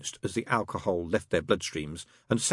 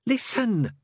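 much higher, pressing call; urgent; 3 dB louder than usual. Acted. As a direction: grab his attention urgently. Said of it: "said Crowley urgently".

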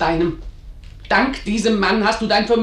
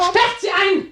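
much higher, commanding cry; furious order; 11 semitones higher, slower than usual. Acted. Bernarda orders the servants to lock her mother away, furious high cry.

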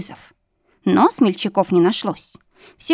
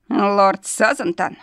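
fire demon; much slower than usual. Acted. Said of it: "уточнил демон".

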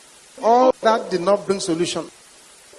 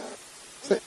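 quiet; 14 dB quieter than usual. Real, not acted. Whispered aside in story.

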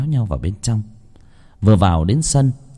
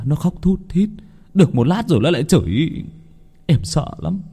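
frightened husband; 7 semitones higher than usual. Acted. Frightened husband explains possession.

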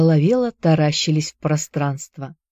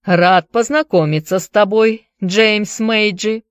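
measured; 4 semitones higher, 3 dB louder than usual. Acted. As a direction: draw out each word, Irish lilt. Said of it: "размеренно произнёс".

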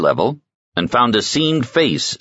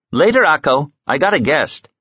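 calling out; 9 semitones higher than usual. Acted. Jean calling to cook across kitchen.

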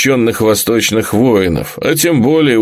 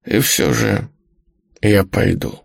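creaky whisper; 4 dB quieter than usual. Acted. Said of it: "натреснуто прошептала она".

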